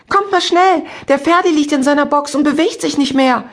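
loud, high panicked yelling; bawling across the yard. Acted. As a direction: shout for help across the yard. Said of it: "plärrte eine Stimme quer über den Hof".

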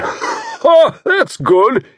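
loud, high bark of a laugh into the words; exploding laugh. A narrator's reading. Hearty man bursting out at Tommy's joke, loud. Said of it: "he exploded".

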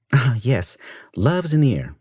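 dry, mocking lilt; sarcastic. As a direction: sneer it playfully. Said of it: "Now who's being sarcastic?".

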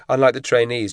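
plain reading voice

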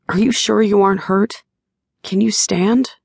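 gently checking on her; lightly voiced worried partner; concerned Shane. A narrator's reading.

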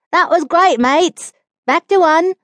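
lightly voiced Australian accent, called out high; a hint of Australian, calling to the crew. A narrator's reading. Australian assistant director calling out to the crew, light Australian accent. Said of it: "the Australian accented first assistant director's voice".

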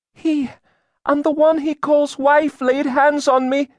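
put-on very high, loud accusing girl's voice; entranced girl. A narrator's reading. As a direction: testify in a trance, high-pitched throughout. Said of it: "She spoke as if in a trance".

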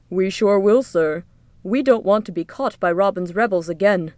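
lightly put-on guard's character voice; mock-dutiful yell back. A narrator's reading. Disguised rebel joker to the taxman, mildly yelled back. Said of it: "Merrick yelled back".

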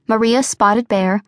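ordinary delivery

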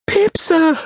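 put-on very high small-boy voice; frightened child's answer. A narrator's reading. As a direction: answer timidly, pitched right up.

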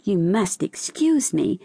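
ordinary delivery, nothing marked

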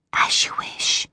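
unvoiced fading ghostly whisper. A narrator's reading. Oracle spirits yield to Elle in a whisper. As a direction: let it drift away in a whisper.

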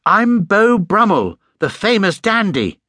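high, slow, affronted announcement; upset protest. A narrator's reading. Bear upset at being called a penguin, indignant protest. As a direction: spell out indignantly who you are. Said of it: "looking most upset".

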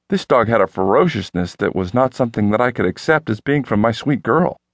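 ordinary delivery, nothing marked

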